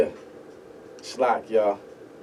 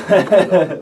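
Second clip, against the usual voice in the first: laughing; 9 semitones higher, 12 dB louder than usual. Real, not acted. Laughs after the praise.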